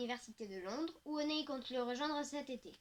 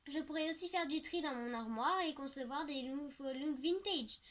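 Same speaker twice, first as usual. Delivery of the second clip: ordinary delivery, nothing marked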